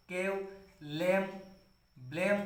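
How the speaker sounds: केव, लेम, ब्लेम read slowly and softly, each vowel stretched as "ए"; slow word demo. Shown as a demonstration. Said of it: "जैसे सेव".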